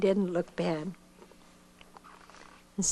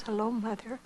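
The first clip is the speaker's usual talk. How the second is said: choked up; 6 dB quieter than usual. Retold or imitated. And I couldn't answer.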